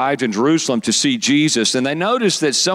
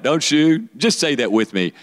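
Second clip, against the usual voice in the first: ordinary talk, nothing marked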